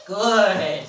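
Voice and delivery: loud, stretched-out praise word; big approving praise; hearty praise. Real, not acted. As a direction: reward the dog with your voice. Praising the dog.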